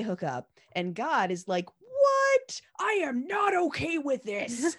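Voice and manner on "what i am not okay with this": a hammed-up outraged shriek that starts high and loud; mock outrage. God objecting to Pharaoh and Sarai, hammed-up outrage. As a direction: play God spluttering in indignation.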